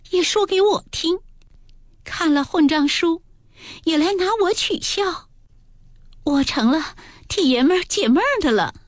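put-on high, tearful, wounded young lady; 委屈地带着哭腔，声音偏高; tearful complaint. A narrator's reading. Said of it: "黛玉便哭道".

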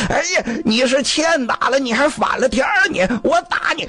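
high-pitched, hammed-up fury; furious threatening. Husband about to beat his wife for talking back, furious threatening. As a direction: threaten her as if temper has snapped. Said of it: "他受不了了".

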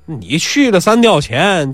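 plain reading voice

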